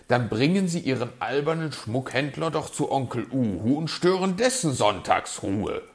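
loud, quick, irritated retort; grumpy outburst; growling fox. A narrator's reading. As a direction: snap it out, voice rising. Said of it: "knurrte der Fuchs".

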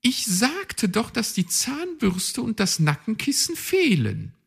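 chirpy, self-assured magpie; high magpie voice. A narrator's reading. Magpie explains her plan, high bossy voice.

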